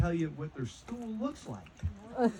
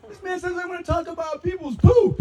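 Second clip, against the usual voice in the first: put-on high wailing child shouting for mom; little kid yelling to mom, much higher and louder; frightened kid yelling; 9 dB louder than usual. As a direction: squeal it like a scared little boy. Child runs crying to mom.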